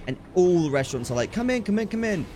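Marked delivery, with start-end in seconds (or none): 1.3-2.2 high, rapid beckoning imitation; eager restaurant touts. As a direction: mimic touts urging customers inside. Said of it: "trying to get us to come in".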